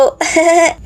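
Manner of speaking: a short, pleased little giggle; sly giggle. Acted. Girl giggling after sneaking the phone into her bag. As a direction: giggle at getting away with it. Plans to film again at school.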